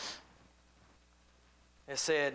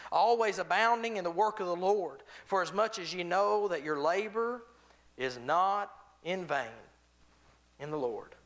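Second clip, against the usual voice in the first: louder, raised preaching read; scripture reading; 4 semitones higher, 4 dB louder than usual. Acted. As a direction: declaim the verse. Reads scripture aloud.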